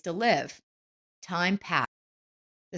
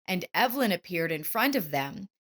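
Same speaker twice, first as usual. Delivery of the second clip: ordinary delivery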